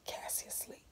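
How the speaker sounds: breathy hushed whisper. A narrator's reading. Mama checking on sleeping Cassie, whispered. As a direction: ask without waking the child. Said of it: "Mama whispered in the doorway".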